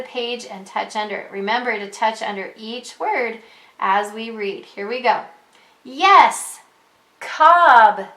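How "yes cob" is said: Ted yelling. Acted. Teacher voicing Ted's line for a young reader, yelling. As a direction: call it out like Ted at the picnic. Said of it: "yells Ted".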